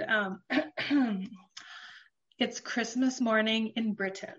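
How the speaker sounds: ordinary delivery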